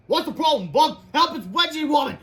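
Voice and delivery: high, loud comic-book dialogue; hammed-up high alarm; cartoon rescue voices. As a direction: call out like cartoon characters. Hero and victim exchange.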